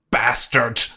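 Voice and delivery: loud mock-furious shout; imitated angry outburst. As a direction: imitate her exploding with rage in one word. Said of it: "Immediately lost her fucking mind".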